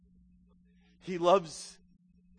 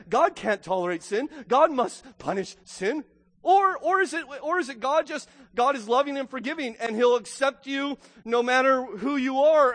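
fervent pulpit voice, louder and punched; preaching at full force; 8 semitones higher, 5 dB louder than usual. Acted. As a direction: hammer home each bad people.

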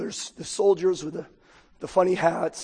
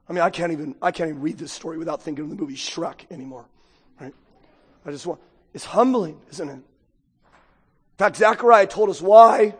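ordinary talk

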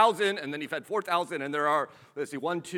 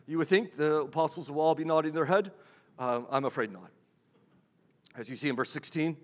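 normal talking voice

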